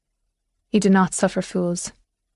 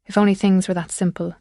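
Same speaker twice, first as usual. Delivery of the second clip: usual speaking voice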